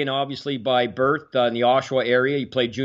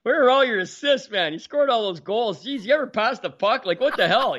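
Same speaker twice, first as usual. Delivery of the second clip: higher, teasing heckle at a star; mock heckling yell; 9 semitones higher than usual. Dale heckling Bobby on stage, a higher mock yell. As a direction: rib him like a heckler from the crowd. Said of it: "Dale's yelling at him".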